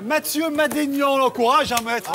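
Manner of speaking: nom annoncé haut et lent, comme un présentateur; annonce de scène. Real, not acted. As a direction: lance l'artiste sur scène, bien étiré. Host introduces guest on stage. (le présentateur annonce l'humoriste au public)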